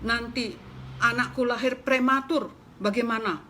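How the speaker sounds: high, loud scolding hen; angry hen scolding. Acted. Hen scolding the mouse for startling her, loud and high. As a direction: rant at the mouse like an outraged mother hen. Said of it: "kata si ayam marah-marah".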